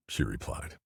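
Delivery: usual speaking voice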